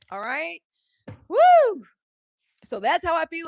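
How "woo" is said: high, loud "woo"; loud whoop. Real, not acted. Host venting to her viewers after a rant, loud high whoop. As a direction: let out a big high whoop.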